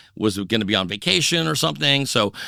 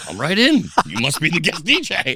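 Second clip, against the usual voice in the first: eager bouncer; 5 semitones higher than usual. Retold or imitated. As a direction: wave the guest DJ right in, eagerly. Bouncer waves him in eagerly.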